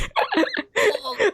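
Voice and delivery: squealing high laugh; a giddy high-pitched laugh. Real, not acted. Laughing at the callback.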